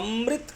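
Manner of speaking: Amrit pushed on अ, the म doubled; stress on first vowel. Shown as a demonstration. Teacher showing how stress adds length, Amrit stressed on अ. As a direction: put weight on the अ of Amrit, holding the म. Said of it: "करते ही अ पे बलाघात बढ़ता है".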